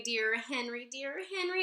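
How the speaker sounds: usual speaking voice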